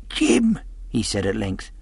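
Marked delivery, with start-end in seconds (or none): 0.1-0.6 put-on high, wheedling old sailor; old sea captain. A narrator's reading. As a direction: coax the boy like a sick old pirate. Captain calls the boy.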